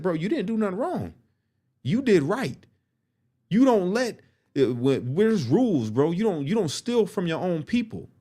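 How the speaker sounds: animated prison mentor voice; Dre's voice. Retold or imitated. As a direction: play the fired-up elder backing him up. Voicing Dre from Detroit.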